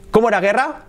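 normal talking voice

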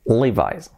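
Levi's as "LEE-vise"; English "li-VAIS" for Levi's; English pronunciation. Shown as a demonstration. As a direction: say Levi's with a final "eye" sound. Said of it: "Ha de ser".

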